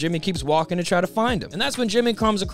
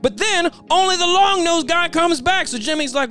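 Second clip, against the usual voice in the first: ordinary talk, nothing marked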